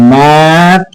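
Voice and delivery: "Mathematics" in pieces with long pauses; syllable by syllable. Shown as a demonstration. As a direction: stretch "mathematics" as ম্যা, থা, মে, tricks. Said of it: "দেখো চারটে syllable". ("Mathematics" চার ভাগে থেমে থেমে)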